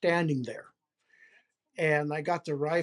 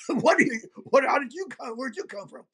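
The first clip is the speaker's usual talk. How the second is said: startled creature; 6 semitones higher than usual. Retold or imitated. Voicing Bigfoot's startled thoughts.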